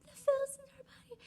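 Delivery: very high, fast repeat of the warning; hurried alarm. Retold or imitated. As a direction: rattle off the warning again.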